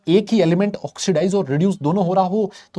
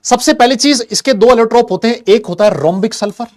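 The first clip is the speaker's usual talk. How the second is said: loud emphatic teaching; 7 semitones higher, 7 dB louder than usual. Real, not acted. Teacher emphasizing key point.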